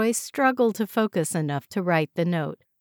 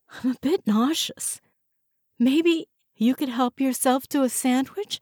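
higher, pained voice of a sick woman; higher, strained voice of a woman feeling faint; ill woman; 6 semitones higher than usual. A narrator's reading. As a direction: let the headache and nausea into each word. Joyce voiced, sick with headache.

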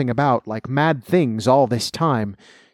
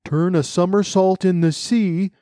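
higher-pitched, lightly excited call; mildly cried out; 7 semitones higher than usual. A narrator's reading. Mock Turtle capering wildly about, mildly cried out. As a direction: call it out, understated. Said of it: "cried the Mock Turtle".

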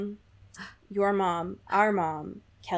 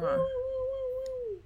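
high siren-like woos; 5 dB quieter than usual. Pretend. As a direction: hoot them up high. Celebration sounds.

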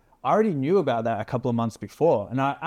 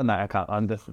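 ordinary talk

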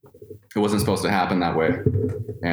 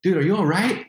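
much higher, worried woman's voice checking on him; concerned lady; 12 semitones higher, faster than usual. Retold or imitated. Lady finding him passed out, asking in a much higher worried voice. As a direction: imitate a stranger asking if he's okay. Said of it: "I heard this lady go".

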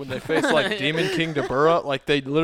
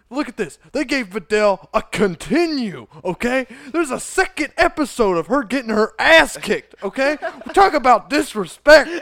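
high-pitched, worked-up mock rant; indignant ranter; 6 semitones higher, 3 dB louder than usual. Imitating a video ranter on disrespect, indignant. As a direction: build the outrage, punching each example. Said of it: "And he's like".

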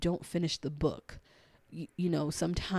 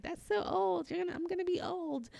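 put-on much higher, fast, whiny junior-high girl; imitating her junior-high self, much higher; teen self; 13 semitones higher than usual. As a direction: squeal it like your younger self. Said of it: "when I was just like".

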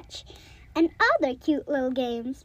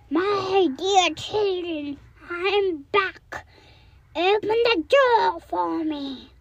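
rough wolf voice; 4 semitones higher, slower than usual. Acted. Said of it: "knocked on the door and said".

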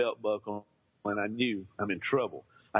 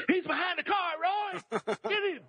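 high mock shout of a nosy neighbor; neighbors yelling. Neighbors shouting to the dad where the boy hides, high mock yell. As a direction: holler it out high to tip off the dad. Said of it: "my neighbors yelled".

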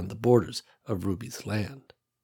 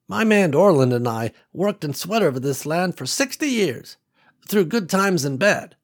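lightly voiced older country woman, a bit higher; old farm woman Ruby; 6 semitones higher than usual. Ruby telling Nicole about her land, lightly voiced old woman. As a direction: let a hint of an old rancher widow in.

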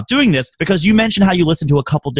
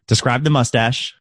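ordinary talk, nothing marked

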